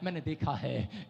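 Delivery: soft and low, slow; a hushed promise; quiet voice of God. Acted. Preacher voicing the Holy Spirit to the congregation, soft and low. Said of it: "पवित्र आत्मा कह रहा है".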